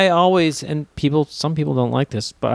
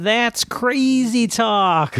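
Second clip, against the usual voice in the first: much higher, very slow put-on voice; hammed-up, stretched mimicry; Ben Miller impression; 8 semitones higher, much slower than usual. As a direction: drawl it high and exaggerated. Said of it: "devolved into Ben Miller impressions".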